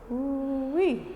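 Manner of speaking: high, delighted ooh-wee as the stretch lands; excited ooh-wee. Real, not acted. Yoga teacher enjoying the forward fold, excited whoop.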